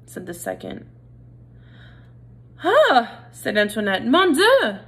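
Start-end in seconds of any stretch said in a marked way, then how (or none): 2.6-3.3 dramatic gasp. Acted. Mother hears son will be eaten. 4.1-4.8 dramatic cry. Acted. Mother hears son will be eaten.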